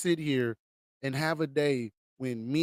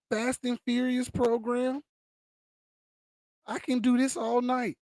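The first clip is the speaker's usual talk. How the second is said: heated listing; 5 semitones higher than usual. Real, not acted. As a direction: fire off grievances one by one. Listing grievances, raised voice.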